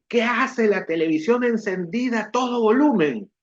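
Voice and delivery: a mother catching the TV on; mom scolding. Retold or imitated. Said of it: "me grita y me dice". (mamá regañando al ver la tele prendida, con voz más aguda)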